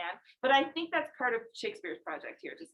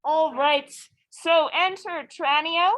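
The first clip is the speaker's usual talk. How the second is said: projecting to the class; 3 semitones higher, 11 dB louder than usual. Real, not acted. Teacher announces scene to class.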